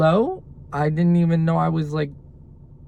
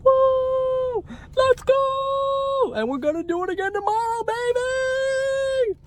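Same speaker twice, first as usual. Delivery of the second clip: excited cheer; much slower than usual. Real, not acted. Vlogger cheering for viewers about hitting goal, whooping high. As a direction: celebrate like you just won.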